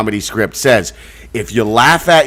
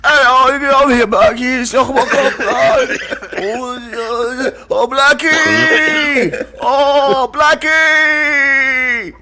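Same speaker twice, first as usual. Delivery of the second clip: high, wailing rock-singer parody; 11 semitones higher, much slower than usual. Acted. Hamming up the [name] joke after the comparison, high wailing impression. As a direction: wail it out high, vowels stretched. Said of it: "He makes you sound like [name]".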